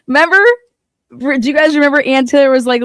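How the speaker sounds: excited. Real, not acted.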